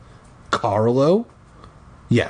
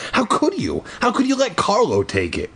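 crying out; 9 semitones higher than usual. Acted. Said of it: "I cried".

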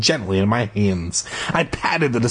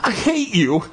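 higher-pitched cry; shouted; 9 semitones higher than usual. Acted. The narrator yells his hatred at the gruel. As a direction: fling it at the creature. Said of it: "I shouted at it".

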